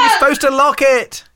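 high, loud shocked squeal; mock panic. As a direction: caught on the loo, shriek in alarm. Cyber controller caught on toilet.

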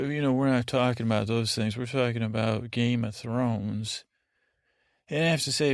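usual speaking voice

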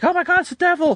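very high, hammed-up horror; mock panic. People burning The Exorcist book, mock panic. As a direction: squeal it like a scandalised book burner.

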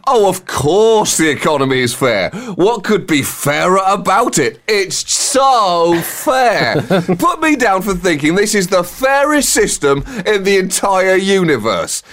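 drawn-out mock agreement, heavily stressed; sarcastic. Poll respondent insisting the economy is fair, heavily sarcastic. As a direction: lay the sarcasm on thick, stretching key words. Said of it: "were actually being sarcastic".

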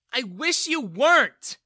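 high, very loud, slow yell. A narrator's reading. Daughter yells at her father, very loud and high. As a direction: hurl it at him. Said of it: "Bella yelled at him".

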